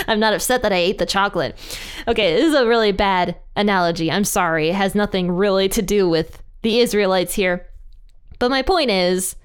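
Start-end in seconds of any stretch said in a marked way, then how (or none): none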